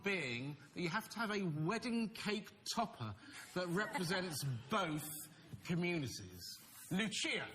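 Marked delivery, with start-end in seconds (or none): none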